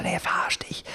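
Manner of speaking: unvoiced whisper, barely audible. [name] whispers a warning during the prank call, whispered. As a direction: whisper it so the caller can't hear.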